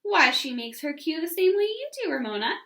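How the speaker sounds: mother noticing the teacher's Q; surprised remark. A narrator's reading. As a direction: point it out with pleased surprise. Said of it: "she remarked".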